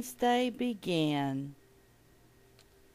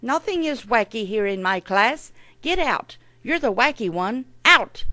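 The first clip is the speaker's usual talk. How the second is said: loud, high scolding, building to a shout; acted cross teacher's outburst; angry teacher; 4 semitones higher, 7 dB louder than usual. A narrator's reading. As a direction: order the pupil out, loudest on the last word. Teacher throws pupil out of class.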